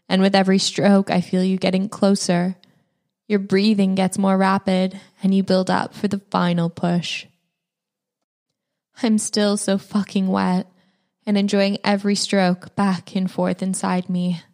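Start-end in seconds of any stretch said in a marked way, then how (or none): none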